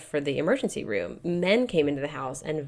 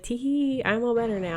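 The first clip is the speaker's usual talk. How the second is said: put-on high, cutesy baby giggle; giggly baby voice; 7 semitones higher than usual. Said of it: "she's like giggling".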